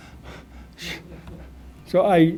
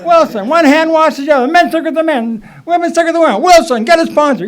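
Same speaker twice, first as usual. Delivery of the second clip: loud, much higher call of the name; Lenny shouting; 11 semitones higher, 7 dB louder, faster than usual. Retold or imitated. Lenny calling Wilson out in front of the meeting, shouting. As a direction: bark his name across the room. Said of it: "he'd say".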